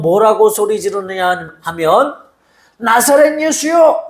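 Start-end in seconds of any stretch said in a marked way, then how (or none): 2.8-4.1 crying out name. Retold or imitated. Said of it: "이렇게 소리 지르지 않고".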